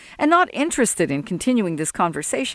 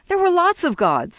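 higher, pointed correction; correcting; 11 semitones higher, 3 dB louder than usual. Acted. Sol correcting Jesse, a higher pointed voice. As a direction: set the old man straight. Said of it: "Sol corrected him".

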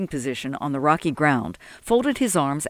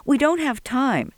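higher-pitched mild protest; 9 semitones higher than usual. A narrator's reading. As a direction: object urgently, voice lifted. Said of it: "Dominic protested".

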